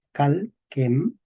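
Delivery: "calquem" stressed on cal, split in two. Shown as a demonstration. Teacher marks the accent, "calquem" stressed on cal. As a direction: lean on the a of "calquem", pause, then quem. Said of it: "El acento va en la A".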